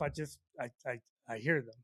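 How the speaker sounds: ordinary delivery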